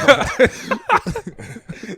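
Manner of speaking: high, loud laugh; real burst of laughter. Real, not acted. Laughing at the co-host's gag-order joke, real amusement. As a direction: crack up at a joke.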